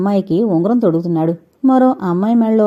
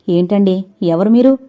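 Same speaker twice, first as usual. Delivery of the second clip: plain reading voice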